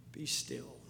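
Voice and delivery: very soft, hushed command; calm the storm with a hush; whisper. Acted. Jesus speaking softly to the winds and waves, hushed. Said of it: "he speaks with a whisper".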